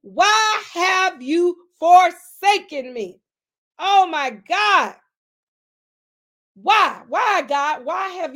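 slow, loud anguished plea; anguished cry. Acted. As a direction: draw it out like a cry of pain. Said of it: "He asked".

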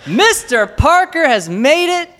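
high, loud, slow show opener; podcast host intro. Acted. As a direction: announce it like a radio host.

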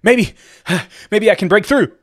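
high, loud, excitable bird voice; frantic cooing pigeon. A narrator's reading. Exhausted pigeon eyes the ceiling, frantic high coo. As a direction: burst out with desperate, breaking hope. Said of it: "he cooed".

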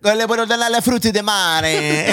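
Spanish line sung out high in a hammed-up Italian accent; mock Italian accent. Host jokes with cohost in a mock Italian accent. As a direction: play a cartoon Italian waiter.

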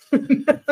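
high, short laugh; quick high giggle. Real, not acted. After teasing Brian about two prizes, laughing.

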